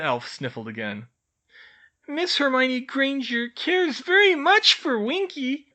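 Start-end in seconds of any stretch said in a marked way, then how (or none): 2.1-5.7 tearful elf. A narrator's reading.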